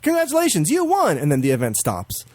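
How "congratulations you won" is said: high-pitched mock victory announcement; game's congratulations. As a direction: cheer like a game declaring a winner. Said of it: "it's like".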